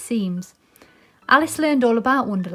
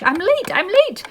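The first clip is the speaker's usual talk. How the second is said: high, loud, hurried rabbit voice; a panicky little rabbit, higher and louder; 8 semitones higher, 6 dB louder than usual. Acted. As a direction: fret like a rabbit who's late.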